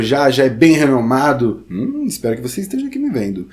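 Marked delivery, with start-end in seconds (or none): none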